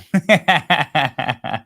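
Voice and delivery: a high, loud laugh. Real, not acted. Host laughing at the pandering clip, loud laugh. Reacting to the clip mocking voters.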